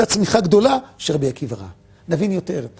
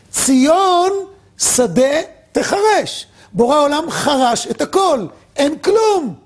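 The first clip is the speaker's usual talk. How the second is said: excited; 7 semitones higher, much slower than usual. Retold or imitated. Akiva's joyful vision.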